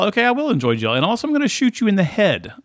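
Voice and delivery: high, hammed-up evil villain voice; mock evil villain. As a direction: play the cartoon baddie, high and silly.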